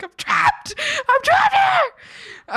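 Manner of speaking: very high, slow, loud laughing cry; hammed-up despair, laughing; laughing wail. Real, not acted. Mock despair at being stranded.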